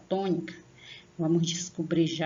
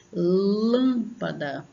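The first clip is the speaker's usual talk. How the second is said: lâmpada very slow, LÂM stretched; sílaba tônica; 2 semitones higher, 4 dB louder, much slower than usual. Shown as a demonstration. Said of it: "por causa do acento". (lâmpada com o LÂM alongado)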